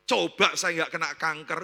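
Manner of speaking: loud, punchy start on "Coba"; emphatic testimony. Retold or imitated. Wife testifies to pastor about cancer's blessing, loud emphatic voice. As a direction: drive the hypothetical home loudly.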